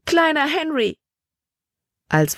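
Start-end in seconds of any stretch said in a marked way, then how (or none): 0.1-1.0 high, joyful cry falling at the end. A narrator's reading. Emma greets her baby son, crying out. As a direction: exclaim it high, as if reunited. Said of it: "schrie sie".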